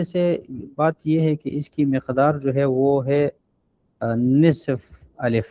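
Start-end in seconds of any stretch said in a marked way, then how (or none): 4.0-5.5 drawn to half alif. Shown as a demonstration. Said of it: "نصف الف کے برابر ہم کھینچ".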